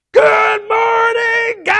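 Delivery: shouting at the top of the lungs; loud shout. Real, not acted. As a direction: yell it loud and proud. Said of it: "So loud and proud, loud as you can".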